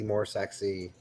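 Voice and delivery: low, soft sultry voice. Shown as a demonstration. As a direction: drop it down low and soft, seductively. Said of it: "get my voice to a, uh, different tone".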